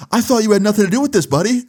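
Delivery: fast, high-pitched confrontation; accusing cop. Retold or imitated. Cop confronts the lying boy.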